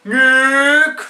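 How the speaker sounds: high loud call of the name; dad shouting. Retold or imitated. Dad calling down the drop, a loud high shout. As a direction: call out across the snow. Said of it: "he shouts out".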